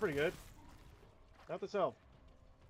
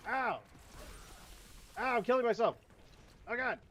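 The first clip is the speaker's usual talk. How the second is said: pained yelp; 7 semitones higher than usual. Real, not acted. Gamer taking damage, yelping in pain. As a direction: cry out like you're getting hurt. Getting hit in game.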